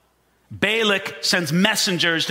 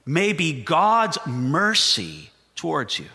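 ordinary delivery

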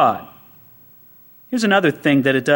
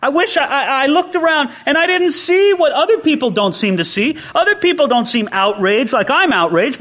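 raised, urgent complaint to God; outraged plea to God; 6 semitones higher than usual. Retold or imitated. Prophet protesting to God about what he sees, raised and urgent. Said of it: "It's like".

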